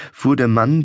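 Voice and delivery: plain reading voice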